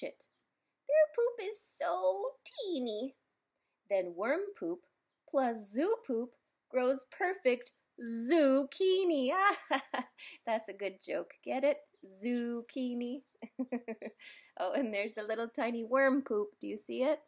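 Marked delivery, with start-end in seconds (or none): 0.9-3.1 high, slow, tiny-thing voice. A narrator's reading. Reading about tiny worm poop. 9.4-10.4 short amused laugh; real laugh. Real, not acted. Laughs at own zucchini joke. 13.4-14.3 quiet little chuckle; soft laugh. Real, not acted. Laughs again after joke.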